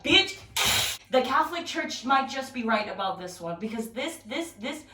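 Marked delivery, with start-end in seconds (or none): 0.0-0.4 menacing bug. Pretend. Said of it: "No, it's like".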